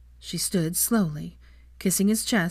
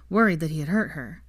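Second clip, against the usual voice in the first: ordinary delivery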